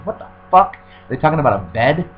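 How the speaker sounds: usual speaking voice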